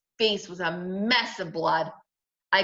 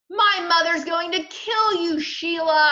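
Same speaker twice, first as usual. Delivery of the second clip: high, loud accusation; angry warning; 10 semitones higher, 6 dB louder than usual. A narrator's reading. Brother blaming Sheila for the lost teeth, angry warning. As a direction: warn her with a raised, heated voice.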